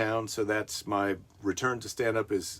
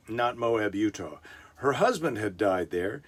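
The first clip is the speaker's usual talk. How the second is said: normal talking voice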